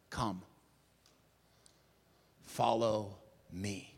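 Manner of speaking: very soft, slow and low; gentle invitation. Acted. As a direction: call him close, tenderly. Jesus's invitation, quoted tenderly.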